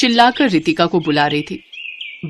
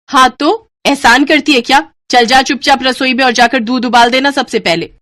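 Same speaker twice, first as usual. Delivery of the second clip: loud, high bossy command; harsh order; 5 semitones higher, 5 dB louder than usual. Acted. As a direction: order her off to the kitchen. Babita orders Ritika harshly.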